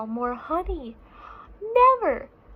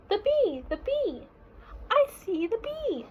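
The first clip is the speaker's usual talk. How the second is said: put-on high, eager child bear voice; excited little cub; 9 semitones higher than usual. Small bear spotting the bee for his dad, high and eager. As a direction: point it out like a thrilled small child.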